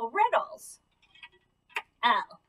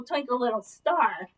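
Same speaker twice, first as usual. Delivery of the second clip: ordinary talk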